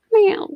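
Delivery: high, loud imitated meow; cat meow. Owner meowing back at the cat, high cat imitation.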